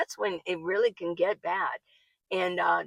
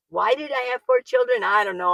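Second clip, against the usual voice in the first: normal talking voice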